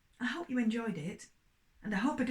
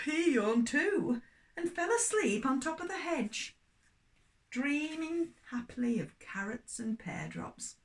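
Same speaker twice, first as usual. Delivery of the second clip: lively storybook reading for little ones; story reading for children; slower than usual. Acted. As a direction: tell it like a bedtime story to children.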